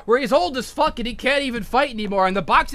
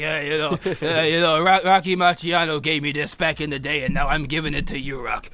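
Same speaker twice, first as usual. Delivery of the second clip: low old trainer impression; Mick; 6 semitones lower than usual. Mick gives young Rocky a keepsake, low gruff impression. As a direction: put on a low mentor's voice. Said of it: "And Mick".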